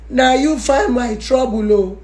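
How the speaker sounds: normal talking voice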